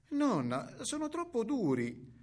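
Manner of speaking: put-on high childlike complaint; little girl voice. A narrator's reading. Girl complaining to grandmother about the food, high child voice. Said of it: "La bambina rimestò ... e disse".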